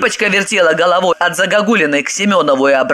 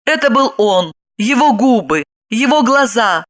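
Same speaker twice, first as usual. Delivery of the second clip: plain reading voice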